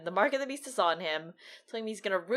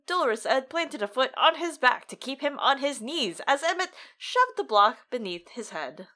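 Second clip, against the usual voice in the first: ordinary talk, nothing marked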